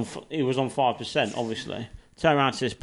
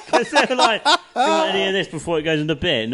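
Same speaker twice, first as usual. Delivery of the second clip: laughing retelling; 7 semitones higher, 5 dB louder than usual. Retold or imitated. Retold staff offer, laughing.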